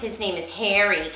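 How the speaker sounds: ordinary talk, nothing marked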